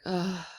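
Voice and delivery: a soft, deflating oh on the out-breath; a quiet, weary oh sigh; frustrated sigh. Shown as a demonstration. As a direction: let the air out like you're fed up. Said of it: "A sigh is when somebody goes like".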